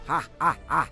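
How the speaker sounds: short, menacing villain cackle; ghostly evil laugh. Acted. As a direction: laugh in short separate bursts as a threat. Ghost laughs after its threat. (डरावनी भूतिया हँसी)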